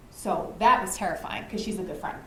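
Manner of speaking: normal talking voice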